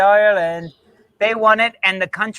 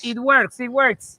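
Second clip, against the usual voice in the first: put-on higher Argentinian accent; 5 semitones higher, slower than usual. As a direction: insist in a mock Argentinian accent. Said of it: "the Argentinian accent".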